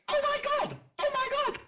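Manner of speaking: very high, squealed mock impression; mimicking Nixie. Correcting Nixie on a catchphrase, squealed high mimicry. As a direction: take it way up high in exaggerated mimicry. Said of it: "get it right, Nixie".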